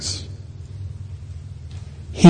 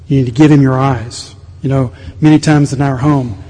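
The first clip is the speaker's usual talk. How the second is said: ordinary talk, nothing marked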